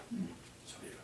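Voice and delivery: ordinary talk, nothing marked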